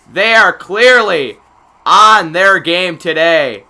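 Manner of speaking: loud, slow, punched commentary; emphatic announcer. Real, not acted. Announcer praising the team after a goal, emphatic. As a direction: land each phrase hard and slowly.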